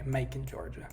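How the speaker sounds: quiet touch of Georgia twang; playful Georgia accent. Pretend. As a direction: savour Macon, Georgia in a Southern US accent. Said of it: "to say in that accent".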